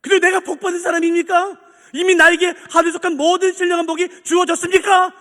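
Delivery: high, loud, earnest cry to God; impassioned questioning prayer. Shown as a demonstration. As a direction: plead the question at full voice. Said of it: "라고 질문해 보세요".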